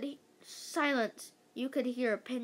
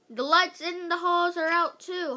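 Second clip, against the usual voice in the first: louder, higher holler down the hall; 7 semitones higher, 7 dB louder than usual. A narrator's reading. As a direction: holler the news across a room. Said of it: "hollered Mister Mackey".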